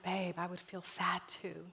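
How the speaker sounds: low, soft comforting mom; tender empathy. Retold or imitated. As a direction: soothe your sad child quietly. Mom comforting sad child.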